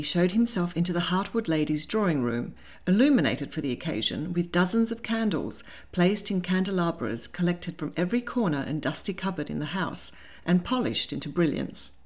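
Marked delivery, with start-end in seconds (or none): none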